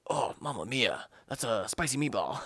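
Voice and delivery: lightly hammed-up Nicolas Cage; Nick Cage overacting voice. Friend mimics Cage hit by spaghetti, lightly hammed. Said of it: "he says it in, like, a Nick Cage overacting voice".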